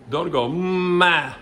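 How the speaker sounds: "ma" sung slow, high and loud as the thing to avoid; wrong syllable demo. Shown as a demonstration. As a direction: stretch out "ma" high, showing the pressure. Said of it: "ma builds up pressure".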